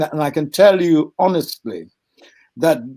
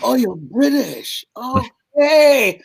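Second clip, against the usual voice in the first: surprised; 9 semitones higher, much slower than usual. Retold or imitated. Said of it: "He went".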